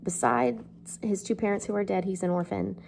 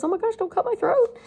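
put-on much higher, louder, panicked child's plea; terrified child; 5 dB louder than usual. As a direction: squeal it like a scared little boy begging. Terrified child Pip begging.

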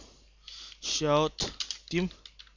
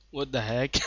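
voice crack; 6 semitones lower, 8 dB quieter than usual. Real, not acted. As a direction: voice cracking on the words. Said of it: "Voice crack, guys".

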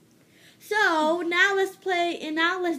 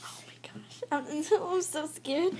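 whispering; 4 dB quieter than usual. Real, not acted. Laughing whisper.

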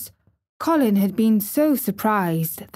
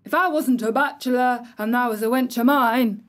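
put-on angry old gardener; 4 semitones higher than usual. A narrator's reading. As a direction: scold her from the wall. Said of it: "he cried".

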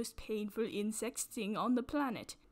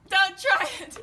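repeated warning shout; 9 semitones higher, 11 dB louder than usual. Acted. Host dubbing the duel, with a louder repeated warning. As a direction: TRY yelled loud and high, falling. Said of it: "doing battle".

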